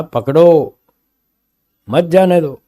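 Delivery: पकड़ो drawn out alone, pause, then मत जाने दो joined; pause after पकड़ो. Acted. Jailer correcting the constable, pause moved before मत. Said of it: "मैंने ये थोड़ी कहा था".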